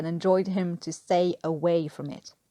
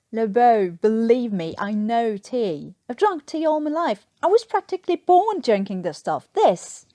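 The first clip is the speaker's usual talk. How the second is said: higher and louder, exasperated lecture; indignant rant; 3 semitones higher, 6 dB louder than usual. Acted. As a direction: lecture him in outraged disbelief. Newkirk ranting about bad tea.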